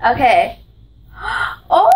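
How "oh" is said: high gasp into oh; surprised gasp. Real, not acted. Finds unicorn gift.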